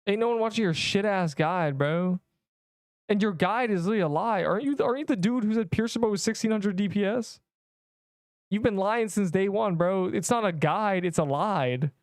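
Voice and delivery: mock heckler. Acts out heckler mocking his guide.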